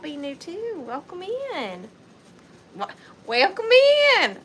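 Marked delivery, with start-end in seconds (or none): 2.8-4.4 exaggerated Southern accent. Shown as a demonstration. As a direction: lay the Southern twang on thick. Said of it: "I don't usually talk like that".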